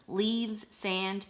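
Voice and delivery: ordinary talk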